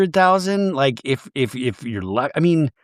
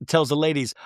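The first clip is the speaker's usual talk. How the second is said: ordinary talk, nothing marked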